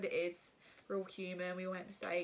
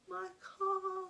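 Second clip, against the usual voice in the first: high tearful wail; crying; 11 semitones higher than usual. Retold or imitated. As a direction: wail it like your heart just broke. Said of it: "started crying".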